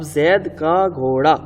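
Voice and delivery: slow, deliberate example phrase; slow dictation. Shown as a demonstration. Teacher showing students the example phrase to translate, slow dictation. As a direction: draw out each word for the learners.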